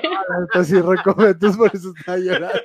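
high, loud, laughing through the words; real laughing confession. Real, not acted. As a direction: own up while cracking up. Teased about snoring, cracks up.

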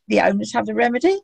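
ordinary talk

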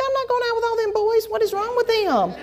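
high, panicked retelling of her own alarm; freaking out. Retold or imitated. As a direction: squeal it like a scared teen girl. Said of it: "I'm, like, freaking out".

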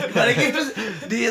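laughing. Real, not acted. Joking about returning the gift.

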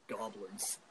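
low, very quiet single word; hushed, deep-pitched character impression; Goblin Slayer. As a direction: mutter it low like a grim hunter. Impersonating the Goblin Slayer's one-word line.

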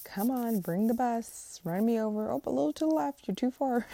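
high, loud, eager voice; eager self-sacrificing senator. Retold or imitated. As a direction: play a senator begging to be run over. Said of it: "be like".

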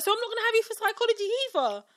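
high, loud, indignant protest; mock dismayed student. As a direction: complain as a pupil losing a second class.

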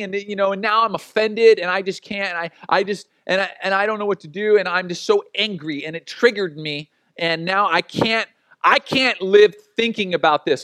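hammed-up upset church member pouring it out; whiny offended congregant. Congregant complaining to the pastor, mock whiny and upset.

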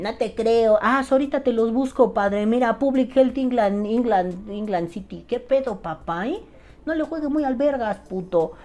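high-pitched, cocky parody of a doubter; mock skeptical arguer. Doubter looking up sources to prove a pal wrong, mock cocky. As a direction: argue back in a put-on high voice. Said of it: "Que te digan".

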